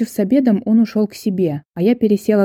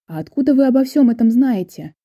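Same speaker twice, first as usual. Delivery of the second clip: mildly surprised question, pitched up; 6 semitones higher than usual. A narrator's reading. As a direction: ask with a touch of amazement. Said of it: "удивлённо уставился он на меня".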